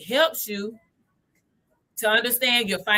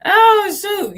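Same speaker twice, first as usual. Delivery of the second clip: sudden surprise; 12 semitones higher, 10 dB louder than usual. Real, not acted. Suddenly notices something in chat.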